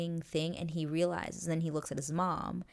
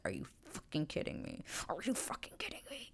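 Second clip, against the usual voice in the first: seething whispered outrage; pissed disbelief; 8 dB quieter than usual. Retold or imitated. Anthony fuming after Kate leaves him, whispered seething. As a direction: hiss it under your breath in disbelief. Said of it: "he is pissed 'cause he's like".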